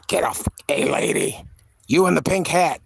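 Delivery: lightly voiced grumpy old man shooing someone off; a hint of an old man yelling at a lady; crotchety old man. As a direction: bark it like a cranky old neighbor. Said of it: "he plays a crotchety old man".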